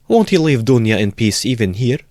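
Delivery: a mother fearing for her daughter; fretful outcry; anxious cry. Acted. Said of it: "Cried Pulcheria Alexandrovna".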